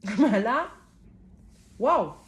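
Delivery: loud laughing wow. Real, not acted. Reader laughing at how striking the cards are. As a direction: laugh out, amazed.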